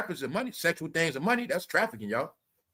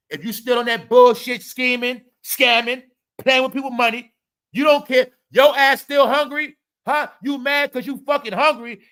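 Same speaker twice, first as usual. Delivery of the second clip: loud, higher angry rant at delivery drivers; raised furious scolding; 5 semitones higher, 6 dB louder than usual. Real, not acted. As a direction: tell them off hard.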